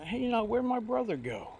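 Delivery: calling down from the roof, pitched high; high called-out question to a worker below; retold holler. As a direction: shout it down into the yard. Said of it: "we hollered down".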